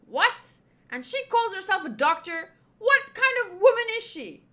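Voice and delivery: high, loud indignant outburst; outraged. Acted. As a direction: demand an explanation in loud disbelief. Outraged reaction to absent doctor.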